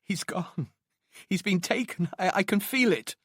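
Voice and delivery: high, fragile despairing woman; growing ever weaker. A narrator's reading. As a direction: voice failing, pitched high and thin on strength. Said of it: "her voice growing ever weaker".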